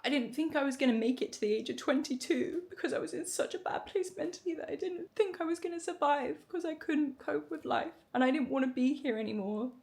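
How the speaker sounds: normal talking voice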